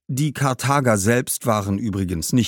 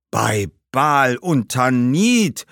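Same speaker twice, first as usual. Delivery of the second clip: very slow, higher ghostly exclamation; blurted-out oath; 4 semitones higher, 4 dB louder, much slower than usual. Acted. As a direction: blurt it out aghast, stretching every word. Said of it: "entfuhr es dem Geist erneut". (entsetzter, lang gedehnter Ausruf)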